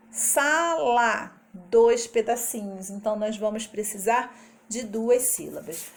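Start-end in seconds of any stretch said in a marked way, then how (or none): 0.1-1.4 Sala said as sa-la, split into its two beats; syllable-split demo. Shown as a demonstration. Teacher sounding out Sala for the children, syllable by syllable. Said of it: "quantos pedacinhos tem a palavra sala".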